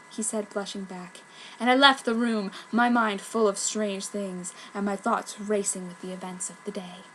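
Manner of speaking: usual speaking voice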